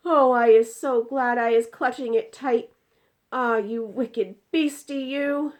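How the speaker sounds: loud, high, triumphant then scolding; gloating giant. Acted. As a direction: scold the wicked beastie. BFG clutching the jar.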